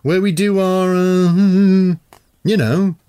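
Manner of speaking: high, loud awkward stammer; embarrassed squirming. A narrator's reading. Teen explaining toilets to warlock, embarrassed. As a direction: squirm through the words. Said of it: "squirming with embarrassment".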